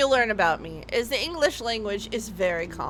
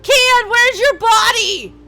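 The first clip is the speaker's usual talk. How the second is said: slow, higher, louder mock yell; 8 semitones higher, 8 dB louder, slower than usual. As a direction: belt it like the frantic mom. Asks Kian, mimicking mom yell.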